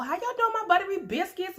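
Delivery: squeaky sing-song hello; high playful greeting. Real, not acted. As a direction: pitch it up for the viewers.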